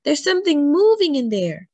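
put-on high, loud alarmed child; crying out. A narrator's reading. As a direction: cry out at a creepy discovery. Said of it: "look, cried Nina".

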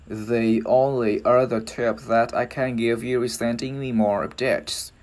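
slow, linked English demonstration; careful teacher's read-through, drawn out; joined-up repeat. Shown as a demonstration. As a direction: model the sentence unhurriedly, words run together. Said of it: "Mình ghép, ghép vào vậy đó".

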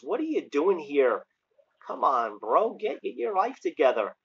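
usual speaking voice

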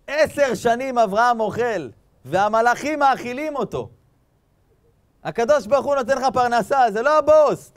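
loud, high-pitched sermon delivery; impassioned preaching. Real, not acted. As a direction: drive the moral home at full voice. Preaches the moral at full force.

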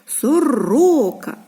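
"Сорока" slowly, second syllable drawn out; stress on second syllable. Shown as a demonstration. Teacher showing children the stress, "Сорока" with a long stressed middle syllable. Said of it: "Наголос падає на який склад?".

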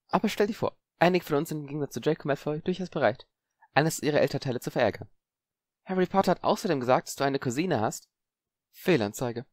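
a grinning schemer; smug boy Zabini. Acted. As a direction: gloat lightly.

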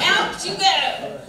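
laughing while tossing the cat out, slow. Retold or imitated. Preacher telling church about cat, laughing. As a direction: chuckle through it as you shove her out.

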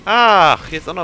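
sudden loud high "ach"; delighted surprise at a find; surprised. Real, not acted. Sudden discovery in game.

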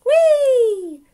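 loud, high, long whee, sliding down; gleeful whee. A narrator's reading. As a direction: squeal a giggly, joyriding whee. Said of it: "she giggled".

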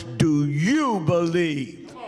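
slow, emphatic stern demand; stern challenge. Shown as a demonstration. Preacher showing how the sick woman demanded it, slow and stern. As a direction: stretch YOU and press it home. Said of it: "It was".

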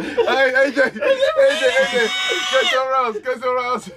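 calling out through laughter; laughing shout. Real, not acted. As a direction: shout his name while cracking up.

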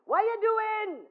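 high, loud call across the street; loud, high greeting shout. Retold or imitated. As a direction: holler it out to a passerby. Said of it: "he never ever once talked softly".

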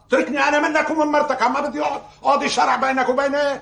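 high-pitched, fed-up protest; exasperated refusal. Acted. Relative refusing to judge between husband and wife, exasperated. Refuses to referee marriage quarrel.

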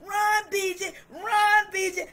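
high, loud cheering from the stands; grandma shouting her grandson to run. Retold or imitated. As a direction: yell him on down the field. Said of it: "you gonna hear my voice saying".

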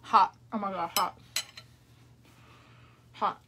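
gasping around hot food; burned tongue, mouth full. Real, not acted. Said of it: "I just burned my tongue".

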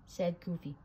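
plain reading voice

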